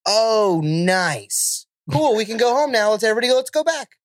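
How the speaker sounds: high, bored, whiny complaining; annoyed whiny girl. Retold or imitated. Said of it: "I hate the... tone of her voice".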